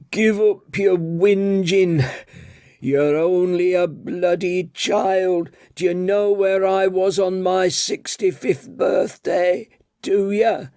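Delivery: put-on wag a finger like a cranky old tea lady; scolding old woman. Old tea lady scolding Daniel for whinging, high old voice.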